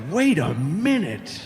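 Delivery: slow, stretched-out disbelief; dumbfounded. Retold or imitated. Boy whose head was spinning at her answer, dumbfounded. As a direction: draw the words out, mind reeling. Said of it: "my head was spinning".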